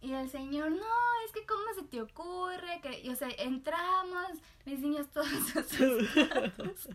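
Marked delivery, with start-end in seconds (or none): 0.7-2.8 higher mock angry yell; angry customer. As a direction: throw the angry question at her. Customer yelling again. (cliente enojado gritando)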